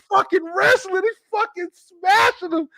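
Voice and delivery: high, strained near-cry shout; screaming at the screen in joy; retold excited yell. Answering his wife, yelling high over the TV. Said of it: "I'm like".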